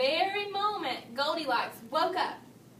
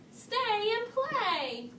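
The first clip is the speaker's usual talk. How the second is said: calling out; 9 semitones higher, slower than usual. A narrator's reading. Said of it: "shouted Baby Bear".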